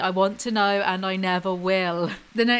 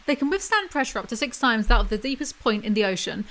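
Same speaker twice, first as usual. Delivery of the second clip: usual speaking voice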